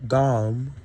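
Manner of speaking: "Dom" said with an open "ah"; "Dom", short and open; Dame as Dom. Shown as a demonstration. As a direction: say "Dom" like the start of "damn". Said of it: "Kinda like damn, but".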